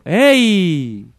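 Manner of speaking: high, drawn-out distant call; echo. Acted. Voice answering from the other side, echoing call. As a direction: stretch it out like an echo, falling at the end. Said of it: "del otro lado una voz le dijo".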